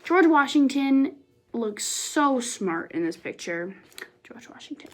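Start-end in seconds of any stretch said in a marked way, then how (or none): 4.2-4.9 breathy awed whisper of the name; hushed reverent whisper. Acted. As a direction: whisper it like a secret. Awed aside at the portrait.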